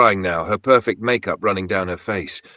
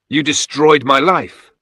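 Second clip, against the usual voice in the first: loud, slow, higher accusation; full voice; 7 semitones higher, 6 dB louder, slower than usual. A narrator's reading. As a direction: let it out at full voice. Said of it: "with my full voice, not a whisper".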